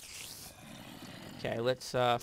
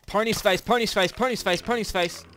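loud, high frantic calls; frantic shouting; 8 semitones higher, 10 dB louder than usual. Real, not acted. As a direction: yell his name in alarm. Yelling teammate's name in danger.

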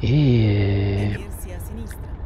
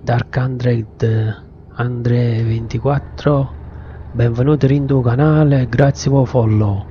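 Neapolitan accent. Shown as a demonstration. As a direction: welcome in Neapolitan dialect. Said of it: "E lo dico pure in napoletano".

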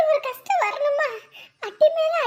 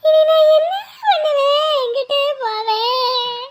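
mock wailing; 2 semitones higher, 4 dB louder than usual. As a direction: cry out to the heavens. Keeps wailing, ignores call.

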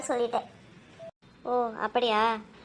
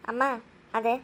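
ordinary delivery

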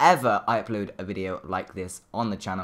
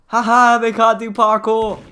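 loud laughing taunt, pitched up; gloating taunt; 8 semitones higher, 9 dB louder than usual. Real, not acted. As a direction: gloat at them from safety. Gloating at stuck murderer.

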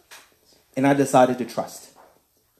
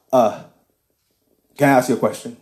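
a hint of a deeper man's voice; 2 semitones lower than usual. The stranger in the truck asks the driver, lightly deeper. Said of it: "It's a deeper voice".

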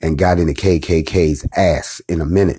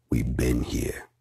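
whispered; 8 semitones lower, 13 dB quieter, slower than usual. Real, not acted. Quiet opening reflection.

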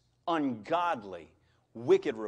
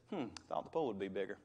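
mock unimpressed kid; 9 semitones lower, 9 dB quieter, much faster than usual. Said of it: "this young little man says".

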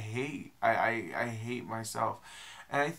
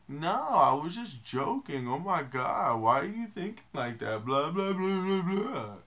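dismissive mock voice, louder and higher on the blahs; mocking quote; 6 semitones higher, 5 dB louder than usual. Vlogger mocking people who claim they were joking. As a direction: mimic their brush-off, sing-song blah blah. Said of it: "And then they tell me".

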